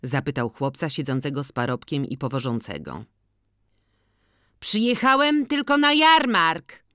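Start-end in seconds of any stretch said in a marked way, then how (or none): 4.6-6.8 high, loud, merry call-out; cheerful call. A narrator's reading. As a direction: sing it out gleefully, voice pitched way up. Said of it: "zawołał wesoło organiściuch". (wesołe, głośne zawołanie)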